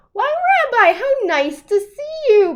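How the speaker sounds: high, loud delighted greeting; surprised delight at the door; exclaiming. A narrator's reading. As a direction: throw the door open with joy. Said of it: "Baba Brena exclaimed".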